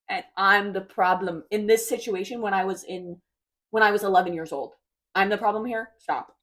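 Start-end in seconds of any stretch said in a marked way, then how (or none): none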